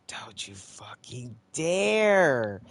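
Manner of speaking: slow warning swelling loud; angry warning. Real, not acted. Player daring the game to fail, warning angrily. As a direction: stretch it out, building to a loud finish.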